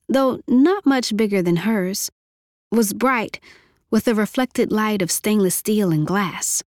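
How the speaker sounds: plain reading voice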